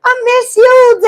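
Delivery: high, loud, sing-song mock sweetness; sugary fake voice. Ex-friend sneaking onto the call, mock sweet voice. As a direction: imitate a caller sweetly faking affection. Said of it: "I hear somebody else like".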